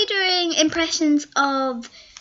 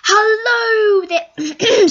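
loud, high, drawn-out hello; iBallistic Squid; 8 semitones higher, 6 dB louder, much slower than usual. Retold or imitated. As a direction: call out a stretched YouTuber greeting. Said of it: "this is iBallistic Squid".

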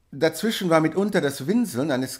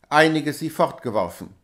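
ordinary delivery, nothing marked

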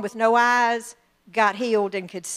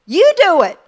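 loud, higher, firm command; God's voice; 5 semitones higher, 8 dB louder than usual. Pretend. God answering praying believers, a loud, firm command. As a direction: hand it back, fully commanding. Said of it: "what do you think God's saying".

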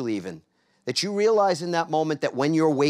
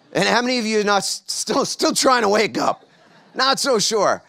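raised, high-pitched crowd tease; loud teasing; 7 semitones higher, 6 dB louder than usual. Real, not acted. Speaker teases those still waking up, loud teasing. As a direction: rib the audience, louder and higher.

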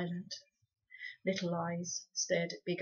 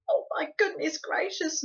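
higher, dismayed moan; 9 semitones higher, 5 dB louder than usual. A narrator's reading. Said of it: "moaned Homily again".

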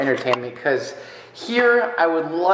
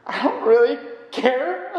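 laughing mock indifference; 8 semitones higher than usual. Real, not acted. Mock indifference about the beard.

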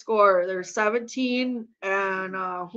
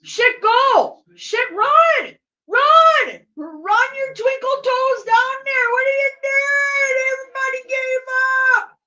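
high, loud yelling at players; real high-pitched sports-fan yelling; shouting at the game; 13 semitones higher, 8 dB louder than usual. Real, not acted.

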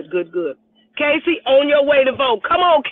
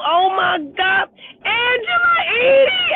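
thrilled cry; 12 semitones higher, 4 dB louder than usual. Real, not acted. Spotting Angela in the comments, thrilled cry. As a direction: squeal with delight at the name.

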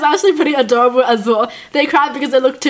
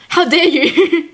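mock outrage; 4 semitones higher, much slower than usual. Mock outrage at chicken shop.

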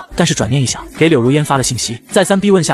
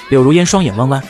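ordinary delivery, nothing marked

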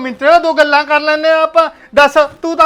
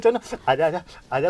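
sleazy coaxing; 8 semitones lower, 11 dB quieter than usual. Acted. Shopkeeper luring her closer.

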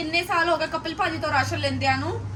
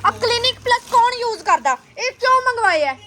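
high, loud exasperated scolding; indignant complaint; dramatic loud aunty; 8 semitones higher, 5 dB louder than usual. Real, not acted. Scolding husband over groceries.

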